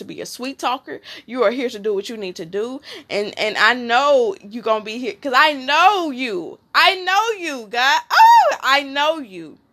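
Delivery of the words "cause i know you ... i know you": fervent declaration. Acted. Prophetic declaration to listener.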